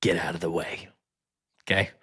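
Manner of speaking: breathy hushed whisper. Acted. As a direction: whisper it, shooing them off. Shooing someone off a call.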